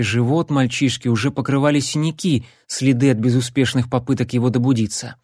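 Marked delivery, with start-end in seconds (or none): none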